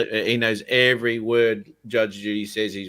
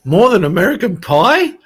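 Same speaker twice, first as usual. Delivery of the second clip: higher, louder mock astonishment; mock amazement; 5 semitones higher, 7 dB louder than usual. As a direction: act stunned, hammed up.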